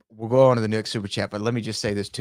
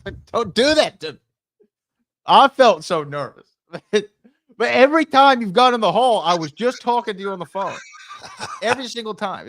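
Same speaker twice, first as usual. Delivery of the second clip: worked up; 10 semitones higher, 5 dB louder than usual. Real, not acted. Laughing, worked up recollection.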